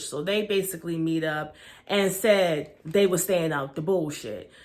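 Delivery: ordinary talk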